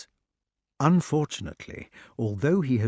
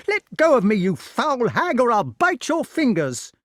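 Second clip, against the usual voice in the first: high, furious yelled threat; loud, desperate boy's protest; crying out; 12 semitones higher, 6 dB louder than usual. A narrator's reading. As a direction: shout wildly while struggling to get free. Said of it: "he cried".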